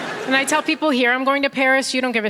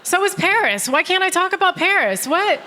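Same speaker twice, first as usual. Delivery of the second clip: exasperated; 8 semitones higher than usual. Real, not acted. Comedian ranting at Londoners about Paris, exasperated. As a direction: throw up your hands, pitched up. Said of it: "exasperated rant".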